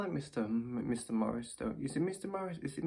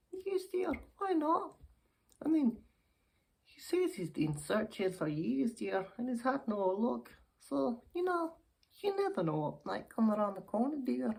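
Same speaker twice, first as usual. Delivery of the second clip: put-on high-pitched, chatty old dear; hopeful old lady; 13 semitones higher than usual. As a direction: play an old lady talking up her suitor. Old lady talks of suitor.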